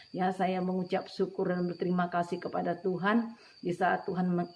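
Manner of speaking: usual speaking voice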